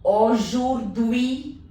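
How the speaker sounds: "aujourd'hui" drawn out slowly, ui clear. Shown as a demonstration. Teacher drilling students slowly on "aujourd'hui". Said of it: "U I is pronounced as ui".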